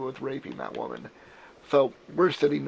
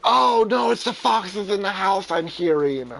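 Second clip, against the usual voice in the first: higher, louder, excited protest; excited correction; 7 semitones higher, 8 dB louder than usual. Real, not acted. Host correcting his cohost about the noises, higher and louder. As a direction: cut in, louder and higher, insisting.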